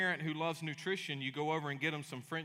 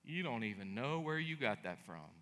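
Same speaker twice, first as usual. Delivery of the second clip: gentle heavenly father; 3 semitones lower, 4 dB quieter than usual. Retold or imitated. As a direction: shake your head with tender patience. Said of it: "shaking his head going".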